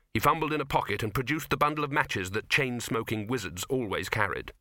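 ordinary delivery

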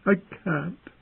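high, tearful protest; plaintive weepy voice; weeping. A narrator's reading. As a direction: say it through tears, high-pitched. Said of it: "she wept".

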